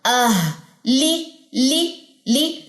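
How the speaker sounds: isolated syllables आह, ली, spaced by pauses; sound drill. Shown as a demonstration. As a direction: breathe out the H of आह, then repeat ली separately. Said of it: "गले के बिल्कुल आखिर में".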